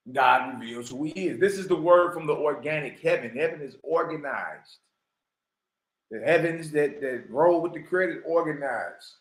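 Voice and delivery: low, measured reading voice; scripture reading. Acted. Reading scripture aloud to viewers, low and measured. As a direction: read it out like holy text. Reads from the Book of Discipline.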